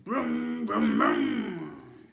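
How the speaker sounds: low loud engine brum; revving engine. Acted. Ned revving the slime bike at the twins, engine noise. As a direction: rev the bike deep and loud. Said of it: "as he revved the engine".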